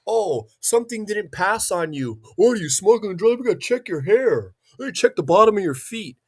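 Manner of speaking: high mock voice of airport screeners; mocking TSA agents. Mocking airport security agents during his pat down, high mock voice. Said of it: "their machine told them that".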